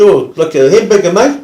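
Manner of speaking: matey rough-customer impersonation; rough offender. Retold or imitated. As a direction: imitate a streetwise customer ordering food. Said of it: "he was quite a rough gentleman".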